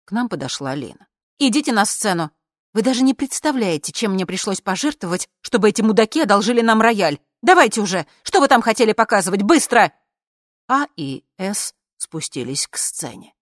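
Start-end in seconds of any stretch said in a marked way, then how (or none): 1.4-9.9 loud, high impatient scolding; irritated, raised-voice commanding; exasperated orders. A narrator's reading. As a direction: hurry them up, fed up. Organiser ordering them on stage.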